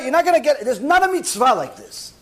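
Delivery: ordinary delivery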